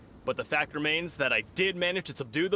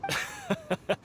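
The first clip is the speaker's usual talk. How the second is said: a big proud laugh, high-pitched; hearty laugh. Acted. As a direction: laugh it off boastfully. Proud fighter laughs at question.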